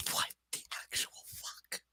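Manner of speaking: hushed, disbelieving whisper; whispered aside. Real, not acted. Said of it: "whispered aside on the book".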